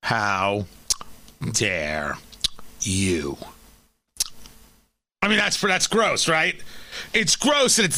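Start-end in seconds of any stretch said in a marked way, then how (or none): 0.0-3.4 much lower, soft, dragged-out mock senator; Chuck Schumer impression. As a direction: drop way down and let the words trail off into a whisper. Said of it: "my Chuck Schumer".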